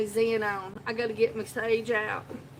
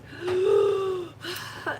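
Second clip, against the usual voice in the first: dramatic higher gasp; mock gasp; 8 semitones higher, 4 dB louder than usual. Down to the last stick.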